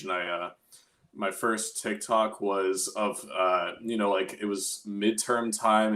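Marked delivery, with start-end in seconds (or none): none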